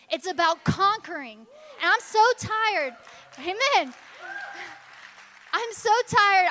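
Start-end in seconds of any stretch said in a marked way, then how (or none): 1.8-6.5 loud, high, impassioned cry; shouting from the pulpit; fired-up preaching. Real, not acted. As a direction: preach it out at full force. Preacher rising to a peak.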